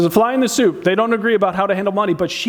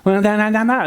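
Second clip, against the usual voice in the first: mocking singsong ma ma ma; a higher mocking imitation of how someone talks; 4 semitones higher than usual. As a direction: mimic someone to make fun of them. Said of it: "Ever have someone make fun of you?".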